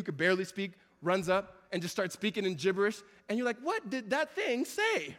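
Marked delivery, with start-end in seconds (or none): 3.6-5.2 slow, higher puzzled question with a laugh; baffled. Retold or imitated. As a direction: ask it slowly, totally lost. Confused listener quote.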